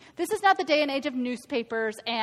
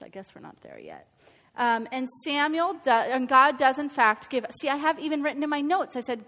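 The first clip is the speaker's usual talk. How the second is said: ordinary talk, nothing marked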